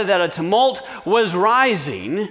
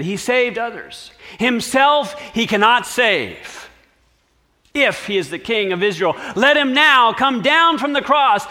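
raised, sneering taunt; higher, louder scornful mockery; mocking; 7 semitones higher, 4 dB louder than usual. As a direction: priests jeering at the cross. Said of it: "also mocked".

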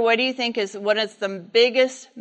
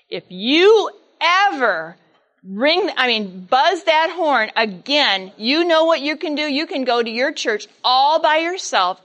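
angry warning; 4 dB louder than usual. Retold or imitated. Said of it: "he'd never heard a tone like that before".

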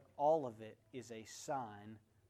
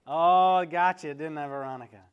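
teasing gotcha; 15 dB louder than usual. Real, not acted. Pastor teasing Veronica, loud playful gotcha. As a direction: catch her out with a loud tease.

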